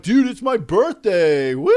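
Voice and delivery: loud, high, slow birthday whoop; rowdy party dude. Retold or imitated. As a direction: cheer like a drunk bro at a bar. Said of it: "he's like".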